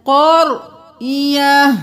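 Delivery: slow melodic tajweed chant, drawn-out vowels; Quran recitation. Shown as a demonstration. Teacher chanting the verse for students, slow melodic recitation. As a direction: intone each word slowly like a reciter.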